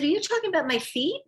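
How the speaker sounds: high, quick, rising question; retold disbelief, checking what was meant; mock-baffled query; incredulous. Retold confused question to him.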